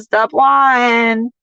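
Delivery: usual speaking voice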